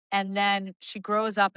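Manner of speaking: normal talking voice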